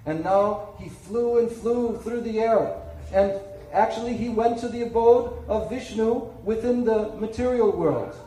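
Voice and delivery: usual speaking voice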